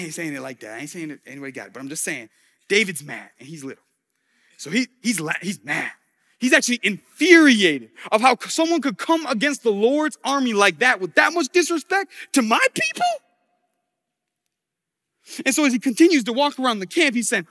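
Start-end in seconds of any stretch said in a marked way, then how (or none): 6.4-13.1 loud, raised, mock-infuriated; worked-up retelling of fury; acted indignation. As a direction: climb higher in outrage on David's behalf. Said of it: "oh, he's fuming".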